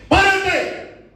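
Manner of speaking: high, loud command to stop; commanding shout. Retold or imitated. Quoting God's command to the demon.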